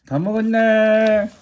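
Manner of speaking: high drawn-out praise. Real, not acted. Praising dog, finished bowl.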